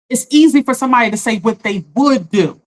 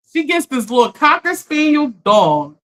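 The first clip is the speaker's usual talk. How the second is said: usual speaking voice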